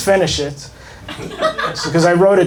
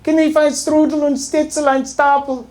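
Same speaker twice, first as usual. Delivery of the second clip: high, hammed-up fake German guard; mock German accent; 9 semitones higher than usual. Auschwitz guard answering the complaining American, in mock German. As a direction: bark fake German like a video game guard. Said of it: "the German would be like".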